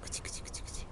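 soft whispered cuți cuți to lure the cat; whispered cat call. Real, not acted. Parent coaxing the shy cat Rolly, whispering. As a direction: whisper it so the cat isn't scared.

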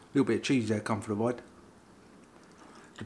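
speaking between bites; talking while chewing. Real, not acted. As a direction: mouth partly full of food. Seen 1: Puts burger piece in mouth at -1, hand at mouth chewing.

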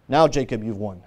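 low, soft divine pronouncement; gentle drop in volume and pitch; hushed verdict. Acted. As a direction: let it sink down quietly. Preacher voices God answering Jacob.

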